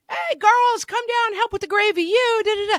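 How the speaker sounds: very high, loud good-natured holler; cheerful holler. Retold or imitated. Mother hollering girls down to help with gravy, cheerful holler. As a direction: call the girls down from across the house. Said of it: "everyone's kind of at a loud volume".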